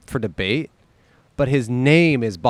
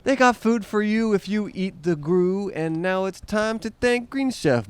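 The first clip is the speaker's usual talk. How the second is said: ad read; 6 semitones higher than usual. Acted. Ad read promo code.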